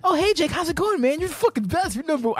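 mock sweet flattery to his face; fake friendly greeting. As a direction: gush fake compliments in a high voice. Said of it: "I don't wanna be the person to be like".

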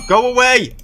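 high, loud shout to shoo it off. Real, not acted. Gamer shouting at the ghost, loud and high. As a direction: yell it away in a panic.

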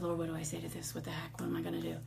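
low, soft aside to God; muttered prayer. Retold or imitated. Asking the Lord what to do, muttered. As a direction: mutter it under the breath. Silent retold prayer for help.

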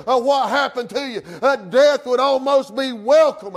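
loud, high, driving sermon cadence; full-force preaching. Real, not acted. Preacher warning the church they're no Samson, full-force preaching. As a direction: hammer each phrase from the pulpit.

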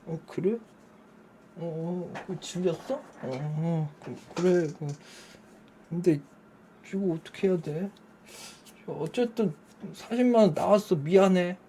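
soft, hesitant mumbling apology; timid apology. Retold or imitated. Husband apologizing to angry wife, soft timid stammer.

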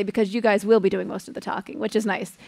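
ordinary talk

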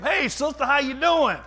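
high, loud friendly hello to fellow members; mock cheery church greeting. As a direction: call out a bright welcome to the brothers and sisters.

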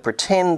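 "pretend" stressed on TEND; second-syllable stress. Shown as a demonstration. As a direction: say "pretend" as pre-TEND, first syllable weak. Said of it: "the second syllable is stressed".

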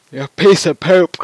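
high, loud yelled insult; angry shout. Real, not acted. Gamer insulting the bride boss, loud high angry shout. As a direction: scream the insult right at the enemy.

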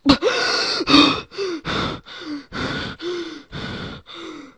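loud, high coughing that gradually fades; long coughing fit, loud then dying away. Real, not acted. Fit after the cry. (quinte de toux bruyante)

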